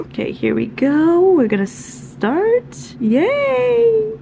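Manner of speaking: rising glee into a loud cheer; excited cheer. Real, not acted. Starting reading timer, cheering.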